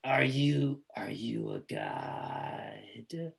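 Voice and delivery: very low, slow whispered creature. Acted. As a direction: draw it out as an eerie monster. GM voices creature asking.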